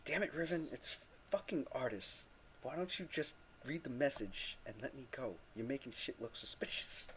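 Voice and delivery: soft hushed aside, low and urgent; a hushed warning from the side of the mouth; whispering under his breath. Acted. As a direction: hiss it so nobody nearby hears. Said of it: "He whispers under his breath".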